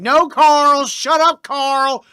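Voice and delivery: loud, high yell of abuse; hammed-up angry shouting; mock scolding shout. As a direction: bellow it at him like a bully. Acts out being mean to Carl.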